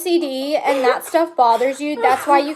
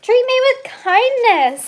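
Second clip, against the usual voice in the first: even higher, slow mock pleading; 11 semitones higher, 4 dB louder, slower than usual. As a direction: push the begging further, higher. Pleading mock plea to mom.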